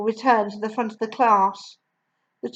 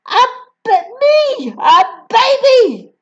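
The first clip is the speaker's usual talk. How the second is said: high, louder outraged shriek; 12 semitones higher, 6 dB louder, slower than usual. Acted. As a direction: shriek in disbelief. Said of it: "shrieked the Trunchbull".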